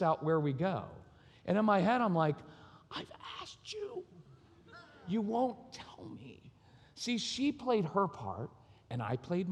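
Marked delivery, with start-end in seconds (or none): 2.9-6.4 slow, strained high squeak, hardly any voice; mock exasperation. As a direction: squeeze out a stifled protest. Silent exasperated protest in his head.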